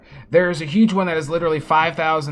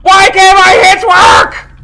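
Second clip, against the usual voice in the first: high screaming rage, slowed; furious yelling; 16 dB louder, slower than usual. Real, not acted. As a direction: scream at the game in fury. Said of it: "Sorry for yelling so loud".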